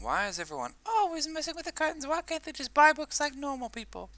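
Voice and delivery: high falsetto complaint; shop owner's grumbling. Acted. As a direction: grumble in a very high put-on woman's voice.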